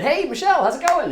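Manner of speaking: high, bright mock-cheerful hello; chirpy greeting. Greeting Michelle on the porch, high and chirpy. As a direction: sing out a falsely sunny greeting.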